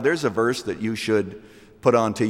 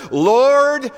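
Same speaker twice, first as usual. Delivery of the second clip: high, loud plea of a sinking man; desperate cry; 13 semitones higher, 7 dB louder than usual. Acted. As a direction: call out for rescue. Peter sinking, calling for rescue.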